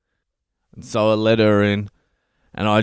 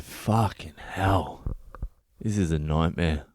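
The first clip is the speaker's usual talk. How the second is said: dismayed groan; 3 semitones lower, 6 dB quieter than usual. Retold or imitated. Dismayed at smashed toilet.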